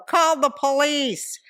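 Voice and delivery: high, drawn-out mock cry for help; retold child's scream. Daughter tormenting mom out the window, mock scream. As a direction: imitate a kid calling out the window. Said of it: "she would scream out the window".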